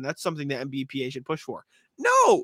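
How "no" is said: sudden high, loud no; emphatic no. Real, not acted. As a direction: cut in hard with no. Cutting off a bad idea.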